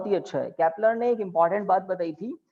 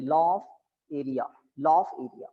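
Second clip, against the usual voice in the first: dictating; 5 semitones lower, much slower than usual. Shown as a demonstration. Dictating the law name.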